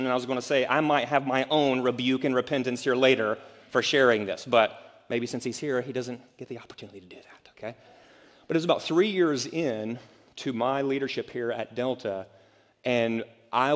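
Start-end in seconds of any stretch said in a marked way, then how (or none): 5.8-7.8 quick conspiratorial whisper; whispered aside. Real, not acted. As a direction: lean in and whisper the joke fast. Joking aside about present friend.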